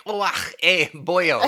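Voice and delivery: mock Irish brogue, laughing at the end; overdone Lucky Charms Irish lilt; bad Irish accent. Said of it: "doing his best Lucky Charms impression".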